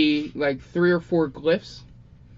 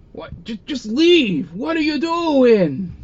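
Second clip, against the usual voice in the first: high, slow, loud Italian exasperation; Italian-flavored outburst; 10 semitones higher, 5 dB louder, slower than usual. Acted. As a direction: throw your hands up, Italian style. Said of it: "why he became Italian".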